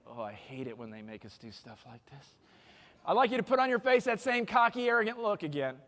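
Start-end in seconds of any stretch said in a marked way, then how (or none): 0.1-2.3 low, quiet mock grumble; grumbling inner thought. Speaker voicing reluctant audience members' thoughts, low quiet grumble. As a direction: drop way down, as if complaining to yourself. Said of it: "what some of you are thinking".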